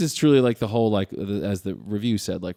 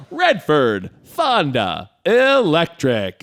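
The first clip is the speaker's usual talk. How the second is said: slow, hammed-up trailer announcer; big mock voiceover, swelling higher and louder on the last word; old trailer announcer; 5 semitones higher, 5 dB louder, much slower than usual. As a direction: draw out each name like a cheesy trailer. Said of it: "old trailers are bad".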